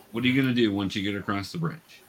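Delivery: usual speaking voice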